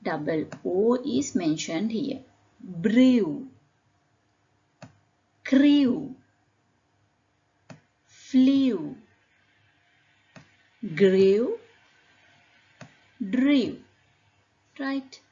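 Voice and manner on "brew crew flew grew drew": "Brew", "Crew", "Flew" said singly and loudly, gliding to oo; oo endings. Shown as a demonstration. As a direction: model each word like "Grew" with a long oo ending. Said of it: "pronounced as oo at the end".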